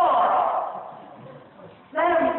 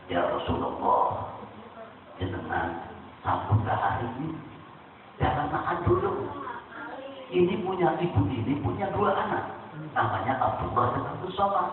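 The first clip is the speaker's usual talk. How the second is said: deep solemn angel; 6 semitones lower, 6 dB quieter than usual. Acted. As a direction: speak low and reverent, like a heavenly messenger. Angel Jibril voiced.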